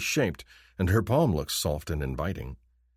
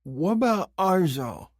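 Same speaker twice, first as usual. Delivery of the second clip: much higher, slow question; alien woman asking; 12 semitones higher, slower than usual. Acted. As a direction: ask it slowly, pitch raised. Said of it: "a question in her voice".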